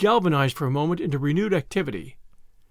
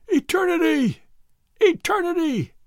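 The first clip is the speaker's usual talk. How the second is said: echoing bawl; 11 semitones higher than usual. A narrator's reading. Blind man's cry echoing down the road, high bawl. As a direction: call it far down the road, pitched way up. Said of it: "came echoing down the road".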